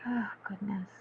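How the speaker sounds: soft unvoiced aside; whispered. Real, not acted. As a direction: whisper it to yourself. Muttering to herself alone.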